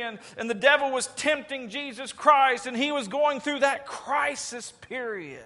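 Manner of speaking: high, loud preacher's build; full-force preaching. Acted. Preacher pressing the congregation about Peter, full force. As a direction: drive it home from the pulpit.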